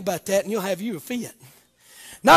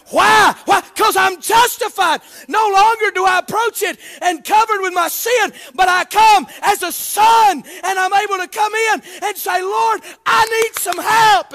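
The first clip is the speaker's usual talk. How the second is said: shouted, higher preaching climax; preaching at full force; 7 semitones higher, 5 dB louder than usual. Acted. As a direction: lift the congregation up.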